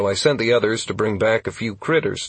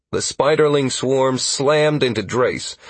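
plain reading voice

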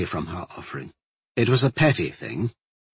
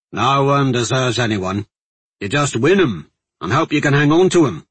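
loud, rough-and-ready guardsman's advice; plain-spoken guardsman, emphatic; 3 semitones higher, 6 dB louder than usual. Acted. Guardsman advising his friend about love, emphatic. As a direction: push it out as a blunt soldier. Voices Jaiska in dialogue.

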